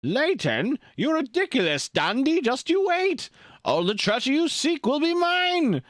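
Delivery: very high-pitched cartoon villain, ending in a cackle; hammed-up villain taunt, voice pushed way up; Doofenshmirtz. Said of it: "I do a Doofenshmirtz voice?".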